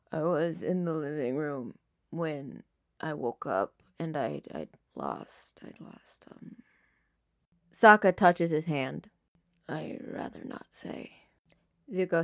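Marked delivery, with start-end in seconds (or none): 0.1-6.6 soft rasp fading into a whisper; hurt man trailing into breath. Acted. Injured Zuko fading to whisper. 9.7-11.1 unvoiced, barely there whisper; injured man whispering his refusal.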